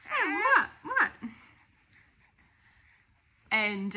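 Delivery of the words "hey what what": much higher playful questions. Real, not acted. Mother answering her babbling baby, high playful voice.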